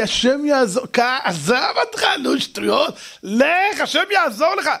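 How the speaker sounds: rising, high dismissive; mocking brush-off. (מבטל בזלזול, בקול עולה)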